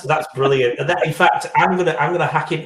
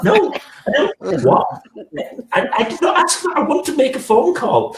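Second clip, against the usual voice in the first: high-pitched mock exasperation; exasperated protest; 14 semitones higher than usual. Driver arguing with the car's voice control, exasperated. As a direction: argue helplessly, voice shooting way up. Acted argument with car voice control.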